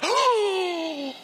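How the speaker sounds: a sharp, high gasp of shock. Retold or imitated. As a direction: gasp like an offended crowd. Shocked crowd reacting to insult.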